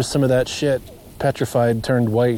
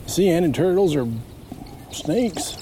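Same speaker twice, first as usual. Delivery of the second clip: lightly imitated American accent, pitched up; 6 semitones higher than usual. Passerby asking about turtles, in a light American accent. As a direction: mimic a passing American hiker's twang. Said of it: "Sounds like he's from the States".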